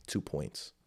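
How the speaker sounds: very low, hushed aside; intrusive-thought voice. Acted. Voice in the driver's head on seeing pedestrians, low murmur. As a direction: slip it out quietly like a stray thought. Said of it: "that's the voice in my head".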